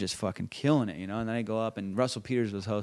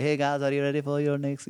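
lightly higher mock Indian host accent; a hammed-up Indian accent, emcee greeting the crowd; bad Indian host; 5 semitones higher than usual. Comedian doing his worst-Indian-host bit, mock Indian accent. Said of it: "the world's worst Indian host".

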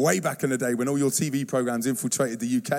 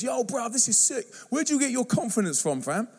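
higher-pitched imitation of an amazed lad; impressed youngster; 7 semitones higher than usual. Youngster to the speaker about his confidence, impressed. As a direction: lightly mimic a street-talking youngster. Quoting a youngster's excited question.

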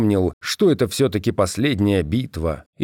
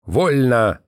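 mild bark, a little higher; barked command; 4 semitones higher than usual. A narrator's reading. Commander to his generals on entering the operations room, barked. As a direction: bark it briefly as a higher-pitched order. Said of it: "и рявкнул".

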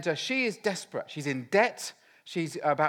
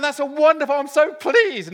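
loud, high burst of gushing; hammed-up, high-pitched retold excitement; acted delight; 9 semitones higher, 13 dB louder than usual. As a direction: gush at full volume, acting overjoyed. Retold delighted reaction to Florida offer.

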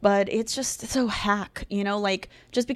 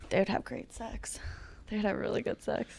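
hushed, very soft confiding; barely audible murmur; whispered aside; 13 dB quieter than usual. Pretend. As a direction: drop to a whisper as if sharing a naughty secret.

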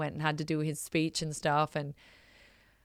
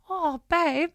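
tender; 12 semitones higher, 4 dB louder than usual. Retold or imitated. Wife tenderly comforting her crying husband, tender and high. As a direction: melt a little as you say it.

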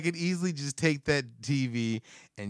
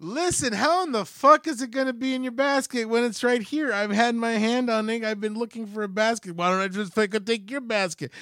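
higher-pitched exasperated retort; retold indignant argument; 7 semitones higher than usual. Shopper arguing over the basket, retold indignation. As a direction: argue back indignantly, pitch up. His acted argument with old lady.

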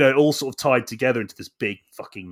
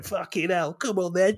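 mock geezer challenge; 9 semitones higher than usual. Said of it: "that guy going".

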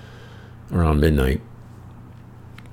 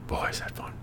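whispered aside; 12 dB quieter than usual. Real, not acted. Wry aside about pub football.

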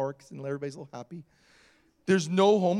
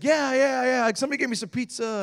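high, hurried rambling reply; imitated eccentric man; 6 semitones higher than usual. Homeless man answering about food, imitated high and quick. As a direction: rattle it off in a pitched-up character voice.

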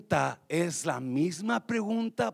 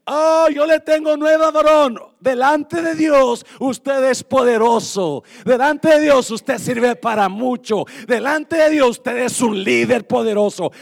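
much higher and louder, proclaiming each line; loud encouragement; 6 semitones higher, 12 dB louder than usual. Shown as a demonstration. Preacher demonstrating to wives how to lift up their husbands, in a loud proclaiming voice. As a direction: shout it out to build him up. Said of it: "Dáselo fuerte al Señor".

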